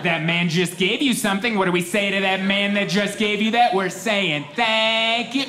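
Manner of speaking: put-on high, sing-song parent voice prompting a child; mock mom coaxing a kid to say thanks. Parent coaxing a stubborn child in high sing-song.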